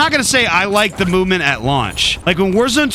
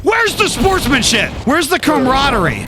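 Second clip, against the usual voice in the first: mock outrage; 7 semitones higher than usual. Mock outrage at enemy proximity chat.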